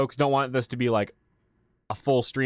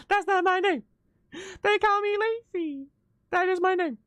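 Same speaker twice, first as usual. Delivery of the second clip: much higher mock wife protesting; a much higher, hammed-up wife's protest; imitating wife. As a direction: play her flustered correction in a high put-on voice. Mimicking wife's clip.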